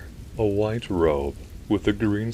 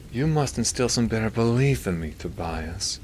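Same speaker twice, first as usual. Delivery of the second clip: a hint of a disinterested sigh; lightly voiced nasal doctor, starting high; Milton; 5 semitones higher than usual. A narrator's reading. Doctor sighing at his priest friend, nasally. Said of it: "Milton sighed".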